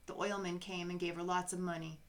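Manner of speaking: plain reading voice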